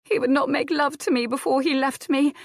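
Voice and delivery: high, loud tearful cry; weeping young widow, voice high; sobbing. Acted. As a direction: break down sobbing the words. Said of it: "Adair sobbed".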